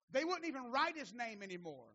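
usual speaking voice

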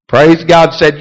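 normal talking voice

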